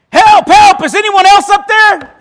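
high, loud panicked cries, ending in a laugh; yelling for help. Acted. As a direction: scream past God for someone else. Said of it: "yelled this".

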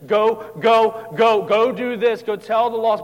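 loud, high, drawn-out urging; commanding Jesus. Acted. Preacher voices a demanding Jesus, loud urgent command. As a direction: send them out with forceful commands. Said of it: "presented as a Jesus who says".

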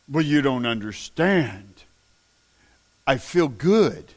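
mock the vaper's excuse. Mocking a vaping excuse.

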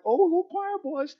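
mocking sing-song falsetto; mocking choir boys. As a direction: sneer at the goody two shoes in a high squeak. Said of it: "I used to be like".

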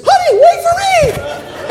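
very high, drawn-out falsetto cry; Missus Smith. Acted. Wife calling after her vanishing husband, comic falsetto wail. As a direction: squeal it as a comic wife being left behind. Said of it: "Missus Smith says".

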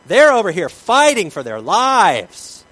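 slow, loud, drawn-out cry; loud dramatic preaching. Acted. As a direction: make them feel the fight for life. Dramatizes disciples' struggle.

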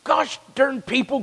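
mock road rage. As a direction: act out fuming at traffic. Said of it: "I get very angry sometimes".

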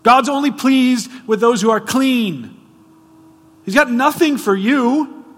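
preacher voicing leaders who scorn Gentiles, loud and sneering; religious leaders' scorn. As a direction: bark it like a proud Pharisee shutting out outsiders. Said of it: "They would have said".

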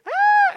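loud, very high mock scream; piercing imitated cry of pain; imitated child's scream. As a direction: shriek like a hurt little boy. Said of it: "He's screaming".

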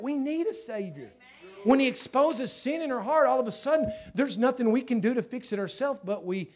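high, loud pulpit urgency; impassioned preaching. Real, not acted. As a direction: drive the point home to the congregation.

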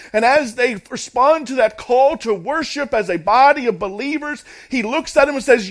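high, loud, driving sermon voice; full-force preaching. Real, not acted. Preacher explaining Paul to the congregation, forceful. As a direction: preach it out to the whole room.

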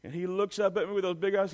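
ordinary delivery

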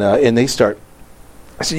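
ordinary talk, nothing marked

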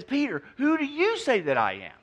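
lightly voiced Jesus, earnest and probing; Jesus asking Peter. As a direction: press Peter for his answer. Jesus questions Peter in retelling.

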